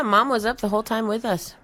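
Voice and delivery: normal talking voice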